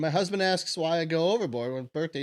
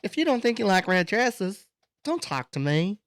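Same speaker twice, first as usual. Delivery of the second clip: mocking the woman; 6 semitones higher than usual. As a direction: parrot her circular talk, higher. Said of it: "She's, like, talking in circles".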